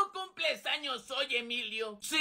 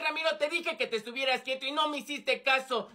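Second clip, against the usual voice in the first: normal talking voice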